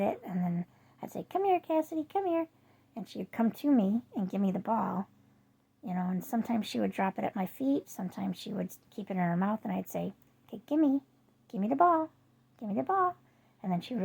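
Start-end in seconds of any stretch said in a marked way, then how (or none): 1.3-2.5 high, sing-song call to a dog; bright pet-calling voice. Retold or imitated. 10.5-13.2 high, coaxing dog-talk; sing-song pet voice. Retold or imitated. Asking dog to drop ball.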